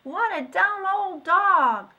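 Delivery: put-on high, loud and slow child voice; jeering children. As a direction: drag it out to mock. Children laugh at the dog.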